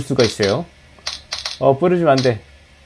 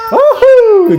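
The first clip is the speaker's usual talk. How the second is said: high loud whoop; whoop of joy; 5 dB louder, much slower than usual. Real, not acted. Gamer whooping as his runner crosses the finish line.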